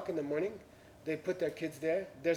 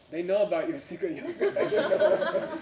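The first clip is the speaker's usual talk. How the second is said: laughing; 4 dB louder than usual. Real, not acted. Teller laughs at own punchline.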